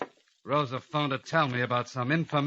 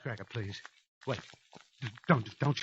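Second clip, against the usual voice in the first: much softer, stammering plea; hushed, stuttering begging; terrified pleading; 2 semitones lower, 7 dB quieter than usual. Acted. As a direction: beg him not to shoot. Begging for his life.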